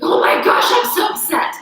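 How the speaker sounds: high, loud, whiny "I'm so upset"; mock upset. Playing a frustrated student to her class, in a high, loud, whiny voice. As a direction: wail it like a frustrated beginner. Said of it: "I'm like".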